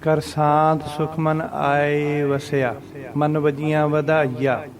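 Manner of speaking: slow, level recital with long vowels; scripture recitation. Shown as a demonstration. Teacher quoting how we read the verse, slow recitation. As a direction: recite slowly and evenly. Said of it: "ਜਿਵੇਂ ਆਪਾਂ ਪੜ੍ਹਦੇ ਨਾ".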